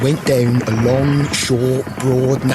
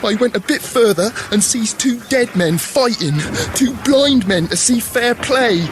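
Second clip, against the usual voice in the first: rising excitement; 11 semitones higher than usual. Acted. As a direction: pitch climbing higher toward a cheer. Mummer's nonsense rhyme climbing higher.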